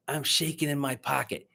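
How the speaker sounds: usual speaking voice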